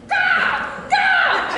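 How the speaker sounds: piercingly high, loud cawing; crow caw. Acted. Performer takes the crow's part, high shouted caws. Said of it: "I'll play the crow".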